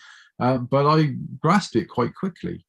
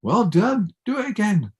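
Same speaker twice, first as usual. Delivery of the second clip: higher, pleased teacher; 7 semitones higher than usual. Retold or imitated. Teacher praising pupil's playing, higher and pleased. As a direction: praise then push on. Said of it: "And he said".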